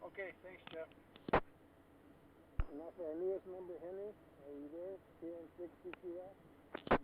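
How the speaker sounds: muffled, mic turned away; talking into a pillow, muffled. Real, not acted. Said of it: "you're talking through a pillow".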